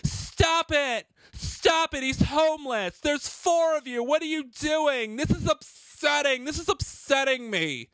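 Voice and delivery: mock a shrill do-gooder scolding the cops; high-pitched mocking protester whine.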